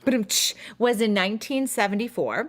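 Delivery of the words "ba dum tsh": beatboxed drum sting Ba-dum-tsh; vocal rim shot. Pretend. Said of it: "I need a rim shot".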